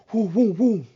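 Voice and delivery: high, loud mock siren wail; playful woo-woo siren noise while telling a chase story; siren imitation. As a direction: make the police siren sound with your voice. Imitating police truck sirens.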